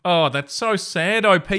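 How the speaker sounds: ordinary talk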